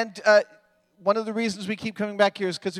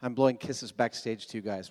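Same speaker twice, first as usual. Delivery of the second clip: ordinary talk, nothing marked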